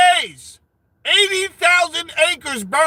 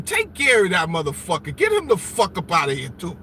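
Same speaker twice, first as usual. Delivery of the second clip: much lower, calm mob-boss command; mock boss order; 10 semitones lower, 3 dB quieter, faster than usual. Streamer ordering pretend security to remove a guest, low mob-boss voice.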